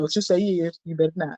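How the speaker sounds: hushed teasing warning; soft mock warning. Real, not acted. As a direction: murmur a playful threat. Friend warns teasingly.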